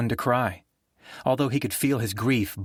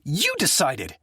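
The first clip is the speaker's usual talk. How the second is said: higher, sharp spat retort; 7 semitones higher, slower than usual. Acted. Jay cuts off his brother, spitting angry. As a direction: spit the words back at him. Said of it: "Jay spit at him".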